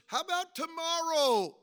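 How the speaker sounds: high, loud challenge from the pulpit; forceful preaching. Real, not acted. Preacher challenging churchgoers about tomorrow, forceful preaching. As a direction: throw the question out hard and high.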